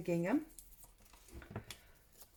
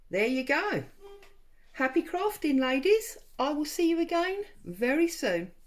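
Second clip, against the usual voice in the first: excited sign-off; 8 semitones higher, 9 dB louder than usual. Real, not acted. Cheerful sign-off to viewers.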